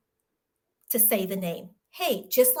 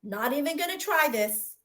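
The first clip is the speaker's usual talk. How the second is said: mock whew leaping far higher and louder, then a fast shrug-off; dismissive sigh; 5 semitones higher, 3 dB louder than usual. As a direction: wave off the name like a chore. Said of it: "being like".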